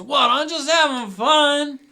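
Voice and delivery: high, loud, drawn-out protest; rowdy town drunk. Acted. Drunk answering annoyed townspeople, rowdy drawn-out holler. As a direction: holler back like a carefree drunk. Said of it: "Crazy Eddie is the town drunk".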